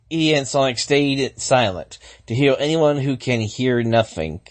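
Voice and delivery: ordinary delivery, nothing marked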